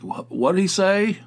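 lightly voiced confused old man. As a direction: mimic a deaf grandpa straining to hear. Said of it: "Like some old man".

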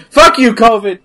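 loud playful curse at covid; mock angry outburst. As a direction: shout it in mock rage.

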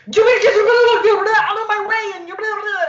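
mock screaming stranger. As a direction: ham up the angry street guy. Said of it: "he started screaming at me".

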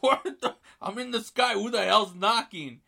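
high, loud retold outburst of disbelief; startled disbelief. Acting startled man hearing a knock.

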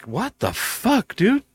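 slow, drawn-out incredulous what the fuck; incredulous outrage. Retold or imitated. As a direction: stretch it out in disbelief. Outraged reply to insult.

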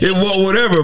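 ordinary delivery

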